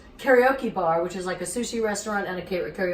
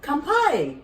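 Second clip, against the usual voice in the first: high toast; bright toast; 7 semitones higher, 3 dB louder than usual. Retold or imitated. Guessing toasts for Koji, high and eager. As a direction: offer it eagerly. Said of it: "I said".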